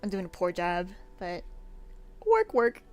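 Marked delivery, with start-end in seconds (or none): 2.3-2.8 very high, loud put-on voice; Warcraft catchphrase. Stream manager keeping everyone on task, high catchphrase. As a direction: pipe it up high like a game character. Catchphrase after apologising for slacking.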